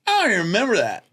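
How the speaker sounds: high, fast, breezy dismissal; high quick offhand voice; imagined co-star. Retold or imitated. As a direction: brush it off quickly. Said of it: "she was like".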